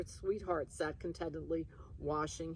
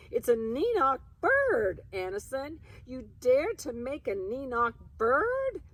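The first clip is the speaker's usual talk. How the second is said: higher, louder indignant rebuke; outraged scolding; 8 semitones higher, 7 dB louder than usual. Acted. Aunt scolding her husband over the bird, higher and louder.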